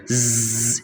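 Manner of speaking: long isolated buzzing ز; just the consonant ز, no vowel; whistling sound. Shown as a demonstration. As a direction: hold ز as a sharp "zzz". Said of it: "اس طرح کی آواز آئے گی اس میں".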